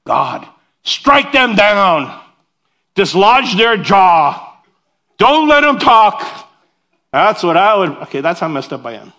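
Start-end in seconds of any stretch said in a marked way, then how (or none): 0.1-6.5 much higher, louder, slow vengeful curse; mock-furious prayer to strike enemies down; mock imprecatory prayer. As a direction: call down wrath in a hammed-up rage. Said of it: "imprecatory prayer".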